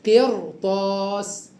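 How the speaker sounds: قرطاس said slowly, ra thick before ط; heavy ra. Shown as a demonstration. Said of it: "يبقى تفخم الراء هكذا".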